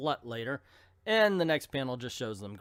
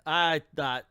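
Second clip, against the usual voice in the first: ordinary delivery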